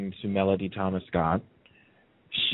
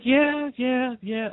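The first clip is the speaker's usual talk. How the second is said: much higher, breezy yeah yeah yeah; mock yeah yeah; much slower than usual. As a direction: toss it off in a high put-on voice. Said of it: "I was like".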